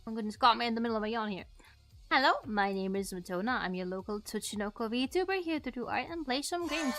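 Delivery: ordinary talk, nothing marked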